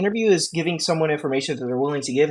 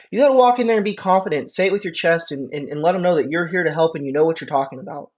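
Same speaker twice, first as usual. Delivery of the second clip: normal talking voice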